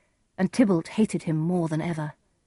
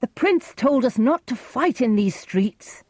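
higher, urgent protest; crying out; 7 semitones higher, 3 dB louder than usual. A narrator's reading. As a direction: plead with the fighters in a raised pitch. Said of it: "Romeo cried".

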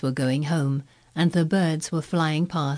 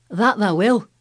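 put-on Yorkshire accent; 4 semitones higher, 6 dB louder than usual. Dickon voiced, Yorkshire.

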